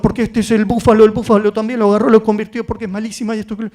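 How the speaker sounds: put-on breathless run-on kid explanation; chattering little boy. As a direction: rattle on like an excited kid without stopping. Acts child chattering on nonstop.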